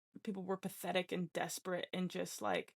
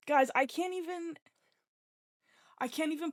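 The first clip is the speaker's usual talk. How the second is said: overjoyed; 8 semitones higher, 4 dB louder than usual. Real, not acted. Overjoyed about new girlfriend.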